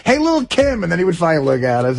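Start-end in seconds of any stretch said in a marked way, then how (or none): 0.1-0.8 mocking call. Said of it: "every time we go".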